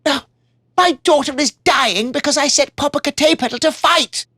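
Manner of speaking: a father blaming himself; troubled king. Acted. King regretting sending the warrior to fight, remorseful. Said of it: "he said".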